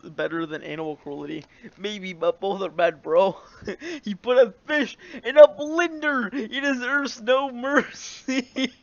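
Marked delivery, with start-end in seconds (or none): 4.0-8.7 mock outrage. Real, not acted. Mock outrage over fish.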